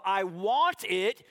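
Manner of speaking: a hint of a pouting kid; childish whine. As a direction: mimic a whiny five-year-old. Voicing a five-year-old's logic.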